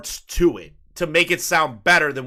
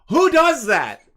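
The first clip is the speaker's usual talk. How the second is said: laughing disbelief; 12 semitones higher, 6 dB louder, slower than usual. Real, not acted. Laughing at a friend's behaviour.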